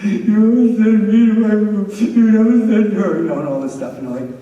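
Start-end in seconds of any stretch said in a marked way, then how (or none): 0.0-3.2 higher, louder whining protest; imitating a whiny crying kid. Son protesting to dad, mock crying whine. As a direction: whine it like a boy caught up in tears. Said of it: "he would start crying".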